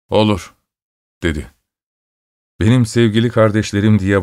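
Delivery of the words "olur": short one-word reply; kısaca. A narrator's reading. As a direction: answer briefly and leave it there. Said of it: "Adam kısaca". (kısa, tek sözcüklük yanıt)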